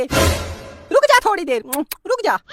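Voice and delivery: soft soothing. Acted. Mother soothes hungry child.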